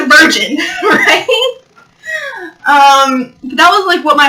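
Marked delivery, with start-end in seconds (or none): 0.6-1.6 laughing. Real, not acted. Laughing at own joke.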